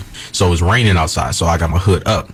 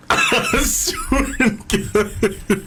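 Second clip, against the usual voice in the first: high, slow, words breaking into laughter; helpless laugh through the words; 4 dB quieter, much slower than usual. Real, not acted. Man swearing his embarrassing story is true, laughing.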